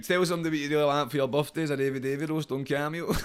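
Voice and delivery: laughing mid-sentence; chuckling retort; laughing through it. Retold or imitated. Retelling reply, laughing.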